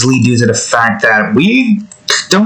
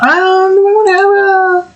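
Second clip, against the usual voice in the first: high falsetto, dragged out; slower than usual. Acted. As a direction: toss it off in a silly squeak. Sudden falsetto aside.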